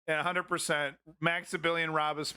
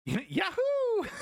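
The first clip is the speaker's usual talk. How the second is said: much higher whooping yahoo; mock yahoo yell. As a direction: whoop it like a rowdy cowboy. Mocking chat full of yahoos.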